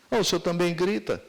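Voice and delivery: voz de filho respondendo, bem mais aguda; criança retrucando. Retold or imitated. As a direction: retruca como criança pega o pai no flagra. (filho rebatendo o pai, um tom acima)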